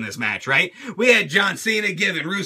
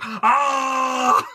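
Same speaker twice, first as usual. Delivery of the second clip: mock roar; 2 semitones higher than usual. Rusev's yell.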